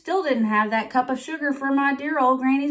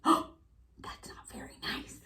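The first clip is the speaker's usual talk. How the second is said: shocked whisper; 12 dB quieter, slower than usual. Acted. Shocked whisper after insult.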